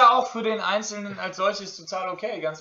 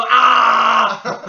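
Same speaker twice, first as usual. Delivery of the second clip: genervter Schrei; 2 semitones higher, 7 dB louder than usual. Retold or imitated. Stress der WhatsApp-Gruppe.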